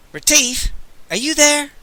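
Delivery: high, thin chirping call. Acted. Unseen creature calling to Retief in the cell, thin high chirp. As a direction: chirp through the dark. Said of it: "a thin voice chirped".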